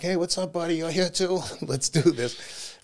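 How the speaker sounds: playful swagger, laughing at the end; cocky greeting. Pretend. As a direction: greet rivals with cheeky surprise. Teasing rivals at the start line.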